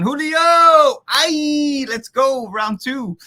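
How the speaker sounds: high loud hype cheer; excited shout. Real, not acted. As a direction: cheer like a crowd at a match. Host cheers guest Julio.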